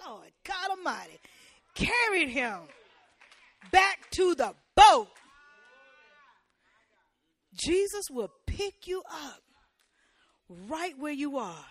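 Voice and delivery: building to a full, high shout; preacher shouting the rescue to the congregation; preaching shout. Acted. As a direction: lift the church with it. Shouting climax.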